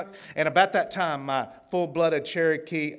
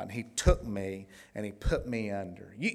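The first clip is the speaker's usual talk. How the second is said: usual speaking voice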